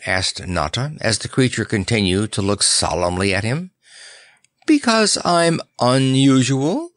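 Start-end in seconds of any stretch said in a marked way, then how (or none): 4.7-6.9 high, drawn-out proud bird; triumphant. A narrator's reading. Strange bird answering the clown's question, triumphant. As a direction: say it slowly, pitched up, with triumph. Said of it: "with a triumphant little hop".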